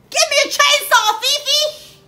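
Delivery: high, loud comic yell; shouting. Acted. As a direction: holler it across the salon. Calls for chainsaw over huge toenails.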